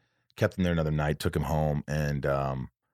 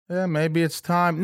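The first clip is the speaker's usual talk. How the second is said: imitated friend, higher and slow; a pitched-up, drawn-out "maybe it's time"; Harlan's reply; 12 semitones higher, 4 dB louder, slower than usual. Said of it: "he always leaves a message".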